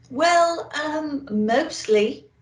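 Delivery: a nervous elf stalling for time; hesitant little elf. Acted. Elf answering Santa, hesitant and slow.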